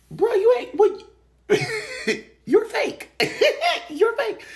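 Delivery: very high, loud squeal through laughter; helpless high-pitched cackling mockery; mocking laughter. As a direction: squeal it in hilarity, calling out a phony. Laughing mockery of fake bold talkers.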